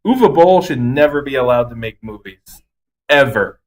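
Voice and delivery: usual speaking voice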